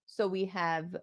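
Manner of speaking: ordinary delivery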